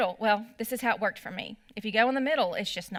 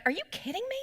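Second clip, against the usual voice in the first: higher, fed-up mock outrage; exasperated at other drivers; 9 semitones higher, 3 dB quieter, much faster than usual. Mom retelling her own outburst at line-cutting drivers, higher and exasperated. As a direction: throw your hands up at the car cutting in. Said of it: "I'd be like".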